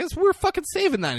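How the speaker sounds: high-pitched retold indignation; put-on outraged complaint; mock pissed off. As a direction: imitate annoyed protest, voice jumping up. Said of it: "Grant's pissed".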